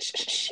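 rapid whispered shushes; imitated cutting-off hush; shushing. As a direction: hush someone mid-word. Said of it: "he continues to shush me".

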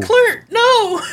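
very high, loud mock scream; hammed-up yell. Echoing co-host's reading of Teddy's yell, high hammed-up scream. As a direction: overdo the comic-book cry in a high voice. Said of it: "and Teddy yells".

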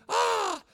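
put-on high delighted squeal of a tossed child; child's squeal. Grandfather imitating grandson tossed in the air, high squeal. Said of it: "He goes".

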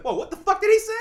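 shocked realization. Retold or imitated. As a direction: blurt it as the news finally hits. Realizing grandma died.

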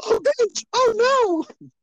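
very high squealing panic; mock panicked Whos. Townsfolk panic at the Grinch, very high mock shriek. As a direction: shriek it like terrified cartoon townsfolk. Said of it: "I mean".

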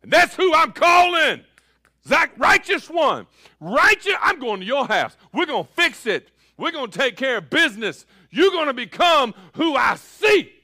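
loud, high, driving each line; full-force preaching. Real, not acted. Pastor declaring who Zacchaeus will become, full-force preaching. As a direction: preach it with fire. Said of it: "I feel like preaching".

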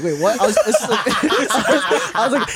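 laughing protest. Retold or imitated. Boy protesting the teacher's confiscation, laughing.

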